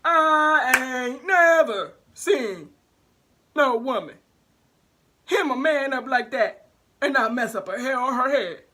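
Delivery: loud, showman teacher calling for applause; teacher's hype voice. Retold or imitated. Teacher stopping the lesson to cheer the narrator, loud emcee hype. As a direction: hype up the class like an emcee, singing a bit. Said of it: "Mister E gonna stop the whole entire lesson to do this".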